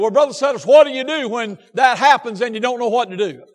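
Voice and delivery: pressing each phrase on the congregation; impassioned preaching. Real, not acted. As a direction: preach it with force. Preaches at full force.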